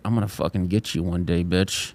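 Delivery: low, soft menacing growl; dog's threat. Pretend. Dog threatening his own reflection, low. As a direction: threaten under your breath. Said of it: "He's like".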